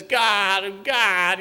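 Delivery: loud, high imitation of desperate crying; hammed-up tearful cry to God; mock crying out. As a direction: wail it like a pitiful, weeping plea. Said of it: "you know, just crying".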